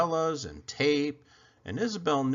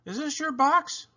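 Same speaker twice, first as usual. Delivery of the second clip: higher, lightly voiced little girl; a curious little girl, pitched up; girl's voice. A narrator's reading. Isabel finds something inside the box, higher girl voice.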